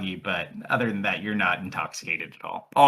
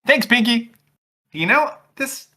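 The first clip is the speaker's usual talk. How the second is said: high, loud put-on voice; loud, high mock thanks; teasing character voice; 13 semitones higher, 8 dB louder, slower than usual. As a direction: tease in a high-pitched voice.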